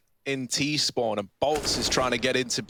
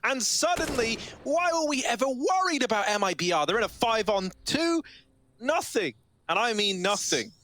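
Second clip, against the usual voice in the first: much higher, hyped commentary; excited play-by-play; 7 semitones higher than usual. Real, not acted. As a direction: ride the wave of the comeback, voice climbing high. Caster hyped after two quick kills.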